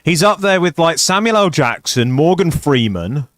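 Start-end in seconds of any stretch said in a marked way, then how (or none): none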